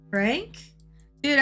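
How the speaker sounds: normal talking voice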